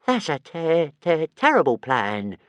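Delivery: stammering little dragon moan; a lightly voiced whiny moan; hint of a whiny small dragon. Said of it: "moaned Toothless".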